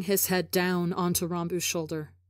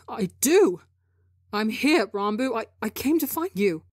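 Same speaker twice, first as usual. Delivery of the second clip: desperate pleading; 3 semitones higher, 4 dB louder than usual. Acted.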